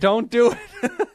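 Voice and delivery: high, slow, laughing don't do it; laughing plea. Real, not acted. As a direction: beg through laughter. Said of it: "laughs; That was perfect".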